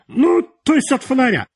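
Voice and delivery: very high character voice, falling at the end; high-voiced editor. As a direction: offer it in a high-pitched editor's voice. Editor's advice, then baffled echo.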